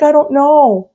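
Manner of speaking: put-on high small-boy voice; imitating little boy. Storyteller mimicking the lost boy, high and small. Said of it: "he's like".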